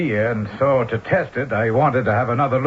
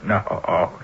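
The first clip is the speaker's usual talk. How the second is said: scoffing laugh; 6 semitones lower than usual. Acted. Scoffs at vibration theory.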